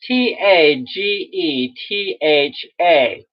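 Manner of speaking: spelled out slowly "T A G E T H A", mock Northeastern; slow, spelled out like Bernie; Bernie spelling. Shown as a demonstration. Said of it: "if you say it like Bernie".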